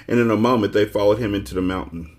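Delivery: ordinary delivery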